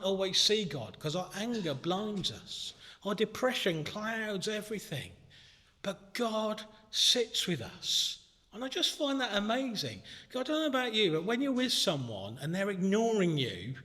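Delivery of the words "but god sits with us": slow, tender, reassuring; slow right down and comfort them; gentle reassurance. Real, not acted. Preacher comforting the grieving congregation, slow and tender.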